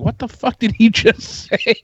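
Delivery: laughing disbelief. Retold or imitated. Retold own reaction, laughing.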